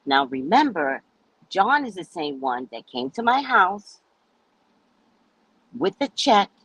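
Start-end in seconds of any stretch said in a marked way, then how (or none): none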